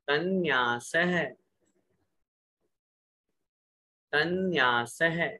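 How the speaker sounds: slow, syllable-by-syllable chanting of the sutra; slow sutra recitation. Shown as a demonstration. Teacher reciting sutra phrases for students to repeat, slow. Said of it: "बोलिए".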